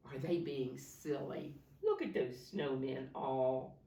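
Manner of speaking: usual speaking voice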